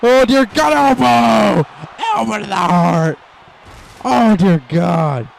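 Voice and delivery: high, loud stunned yelling; excited shout. Real, not acted. Commentator stunned by the elbow, shouting. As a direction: cry out at the elbow drop.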